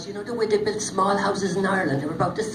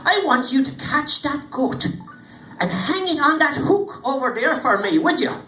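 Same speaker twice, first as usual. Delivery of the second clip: little man's request; 7 semitones higher, 3 dB louder than usual. Acted. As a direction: ask it sweetly, drawing it out. Little man gives the task.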